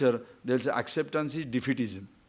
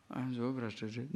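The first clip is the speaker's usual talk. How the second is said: much softer, deflated mock moan; mock frustration; 4 semitones lower, 13 dB quieter than usual. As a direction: sigh it out like a sulking friend. Acting the moody person.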